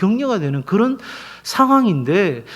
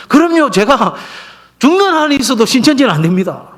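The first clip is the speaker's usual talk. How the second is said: raised-pitch, louder retold pledge; emphatic vow; 6 semitones higher, 5 dB louder than usual. Pastor voicing deaconess's vow against the cult, emphatic vow. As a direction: swear it with total resolve, as a quote.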